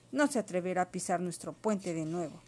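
plain reading voice

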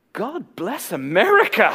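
loud, slow, high-pitched delight; hammed-up smitten outburst; comic awestruck exclamation. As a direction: draw it out big for the punchline. Comic exclamation on seeing future wife.

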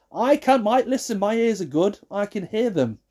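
high, insistent comeback; indignant retort. Real, not acted. Answering those who excuse noisy eaters, indignant.

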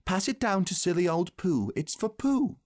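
put-on high, eager boy's voice; excited. A narrator's reading. Christopher Robin sending the present to Pooh, excited. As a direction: hand it over with high-pitched excitement. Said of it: "cried Christopher Robin excitedly".

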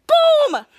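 loud, high victory shout; triumphant boom. Retold or imitated. Coach celebrating the final horn with a loud boom. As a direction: slam it down like a winning buzzer.

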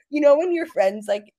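ordinary delivery

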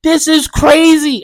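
high, loud, distressed outcry at the family; pleading through tears, half-acted; upset crying protest. Retelling a dream, yelling at family over the dog, upset. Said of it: "just bawling, crying".

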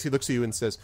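ordinary delivery

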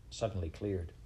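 plain reading voice

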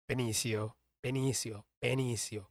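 Benicio said ben-EE-see-oh, low and soft; careful correct pronunciation. Shown as a demonstration. Practicing to break a habit, Benicio as ben-EE-see-oh. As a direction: repeat Benicio quietly with an "s" sound. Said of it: "I always say Benicio".

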